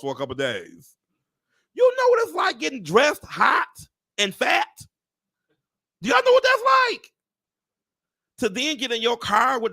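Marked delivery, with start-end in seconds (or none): 1.7-9.7 much higher and louder, rising to a shout; high, loud rant about getting dressed hot; exasperated shout. Real, not acted. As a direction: yell it at the crowd in disbelief. Ranting about dressing in heat.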